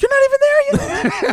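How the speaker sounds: high-pitched mock protest; mock exasperated outburst. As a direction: squawk it in exaggerated disbelief. Retelling a spousal quarrel.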